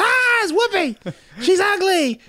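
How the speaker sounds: very high mock shout; crowd shout. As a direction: yell it like a jeering crowd. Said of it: "and everybody goes".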